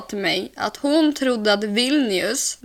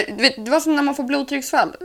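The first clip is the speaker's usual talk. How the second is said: normal talking voice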